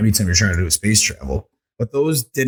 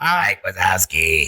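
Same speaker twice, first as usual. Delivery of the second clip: Mike Wazowski impression; much slower than usual. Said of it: "I sound like a frog".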